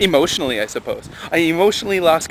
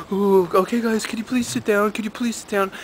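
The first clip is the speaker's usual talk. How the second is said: pleading teacher; 8 semitones higher than usual. Retold or imitated. Teacher begging the noisy kids to sit, higher polite plea.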